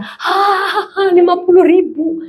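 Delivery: high, drawn-out gasp of delight; delighted surprise. Acted. Wife finding money in the laundry, delighted surprise. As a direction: act out a thrilled windfall.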